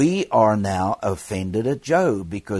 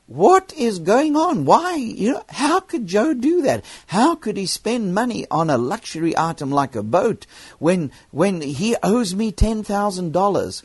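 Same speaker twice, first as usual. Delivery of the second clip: much higher, exasperated what-is-going-on rant; indignant outburst; 9 semitones higher than usual. Retold or imitated. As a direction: throw up your hands at the injustice of it. Inner outrage at Joe's boat.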